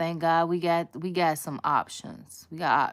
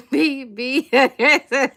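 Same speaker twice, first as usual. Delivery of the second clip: laughing; 8 semitones higher, 8 dB louder, slower than usual. Real, not acted. Laughing at her own chant to viewers, higher and louder. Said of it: "I'm hollering".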